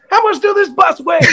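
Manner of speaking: high put-on boss voice breaking into a laugh; milk the punchline, still in character; mock manager, laughing. Manager questions the driver.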